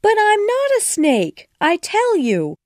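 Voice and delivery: very high, loud, stress on not; insistent. A narrator's reading. Alice insisting to the pigeon, loud and high. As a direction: insist hard, almost shrill-high.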